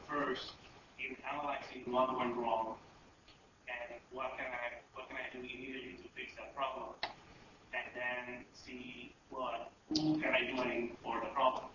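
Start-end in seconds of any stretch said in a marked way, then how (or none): none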